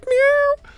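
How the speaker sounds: very high squeaky meow; a cat crying in your face; cat meow imitation. Streamer imitating the cockpit cat, high meow. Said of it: "all you hear is meow".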